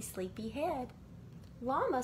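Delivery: ordinary delivery, nothing marked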